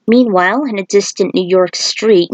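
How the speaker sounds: ordinary delivery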